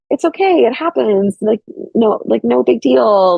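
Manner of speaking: consoling an awkward date after turning him down; lightly reassuring. Retold or imitated. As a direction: soften it for a nervous date. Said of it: "date aftercare".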